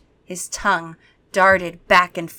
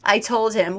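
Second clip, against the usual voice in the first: plain reading voice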